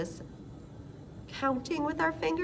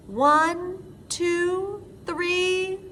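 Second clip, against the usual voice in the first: building count; 7 semitones higher, much slower than usual. Acted. Counting fingers with children.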